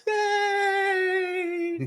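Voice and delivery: very high, drawn-out squeal of a cheer; high mock-crowd hooray. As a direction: cheer like a whole tiny crowd. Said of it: "And everyone's like".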